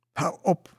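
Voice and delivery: high, loud protest; calling out. A narrator's reading. Kludde to the singing animals, crying out high. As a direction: cry out to make them stop. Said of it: "riep Kludde".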